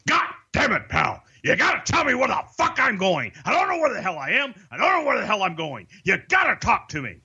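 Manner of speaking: high, fast exasperated boss rant, mimicked; imitating angry boss. Boss furious at his producer over a missed cue, imitated high and fast. Vince blowing up during break.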